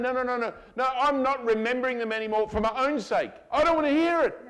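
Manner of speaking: high, insistent, acted refusal; exasperated refusal. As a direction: cut them off, loudly fed up. Acts God refusing to hear sins.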